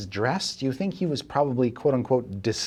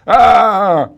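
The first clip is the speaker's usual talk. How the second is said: high, loud grieving cry; anguished shout; 12 semitones higher, 13 dB louder, much slower than usual. Acted. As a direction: howl it over the dead friend. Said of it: "he's like".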